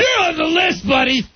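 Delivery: imitating a belligerent drunk yelling; drunken shout. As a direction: bellow it like a drunk picking a fight. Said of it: "that's how you know Rory's done drinking".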